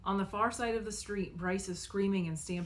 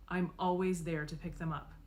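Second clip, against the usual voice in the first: ordinary delivery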